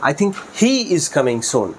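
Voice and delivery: he drawn out and emphasised, slow sentence; stress on he. Shown as a demonstration. Teacher shows that he among many will come, stress on he. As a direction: put the weight on the word he. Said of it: "he এর উপরে stress দেই".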